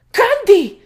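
very high, loud cry of anguish; anguished scream. A narrator's reading. Terry screams as Candy falls from her horse, high and anguished. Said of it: "gritó Terry lleno de angustia".

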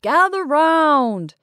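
high, loud, slow drawn-out call; crying out. A narrator's reading. Ringmaster summons the circle for prizes, crying out. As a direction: stretch it out to summon the crowd. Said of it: "he cried".

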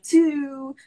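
Baltimore "Two, ooh", drawn out high and loud; exaggerated Baltimore vowel on "Two", held long; Baltimore accent. Streamer teasing how Baltimore says twos, exaggerated Baltimore accent. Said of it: "they say their twos and stuff like".

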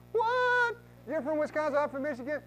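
hammed-up can't-believe-it delight; mock amazement. Client gushing to realtor, high mock amazement.

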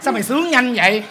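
loud, fast scolding through laughter; laughing scold. Real, not acted. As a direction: tell her off while cracking up. Bà bầu la vừa cười. (vừa cười vừa mắng to)

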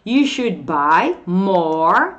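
very slow, word by word; deliberate teacher's model; slow model phrase. Shown as a demonstration. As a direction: stretch out each word for learners. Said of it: "falo assim".

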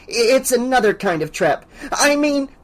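high, flustered backpedalling; stammering. A narrator's reading. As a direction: stumble into it, realising you've slipped up. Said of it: "Missus Deagle stammered".

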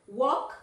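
"walk" as "wok", rising; silent L. Shown as a demonstration. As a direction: say "walk" again with no l. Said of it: "Esa L no".

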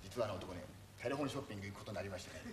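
low, hushed aside; whisper to the teacher. Acted. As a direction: lean in and murmur it. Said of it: "俺は先生にささやく". (先生に囁くように小声で)

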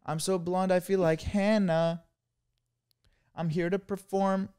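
high, slow pop-star impression; mock blonde singer, drawn out. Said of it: "What would [name] say".

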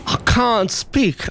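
exaggerated whine. Shown as a demonstration. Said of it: "she's not letting me speak".

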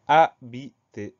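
slow, separated syllables in "habité"; teacher dictating "habité" in separate syllables. Shown as a demonstration. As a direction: sound out "habité" slowly, one syllable at a time. Teacher sounds out the written word.

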